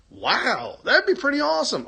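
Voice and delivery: fast and pitched high; excited. Real, not acted. Hearing about recording sunglasses, blurting excitedly. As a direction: blurt it out thrilled.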